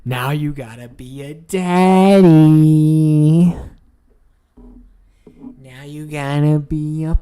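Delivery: loud, slow taunt; hammed-up loud gloat. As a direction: boom it out slowly. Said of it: "They're like".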